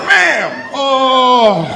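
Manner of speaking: high, loud cry of mock agony; pained yelp. Comic acting out a painful pinch, high hammed-up yelp.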